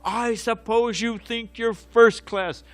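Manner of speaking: high, drawn-out accusing mock shout; angry heckling shout. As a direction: imitate a heckler, slow and high-pitched. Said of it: "it's an angry vibe".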